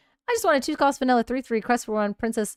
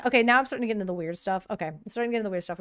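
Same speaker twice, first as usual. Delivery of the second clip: fast mock-nervous patter; much faster than usual. Real, not acted. Rushing through weird content.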